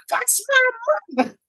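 very high, thrilled pointing out mom; excited shout. Retold or imitated. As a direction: kids bursting with pride. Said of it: "they jump up and they go".

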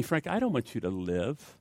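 low, soft, tender counsel; hushed fatherly reassurance; God speaking gently. Retold or imitated. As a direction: speak softly, with pauses, to a guilty man. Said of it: "God says to me".

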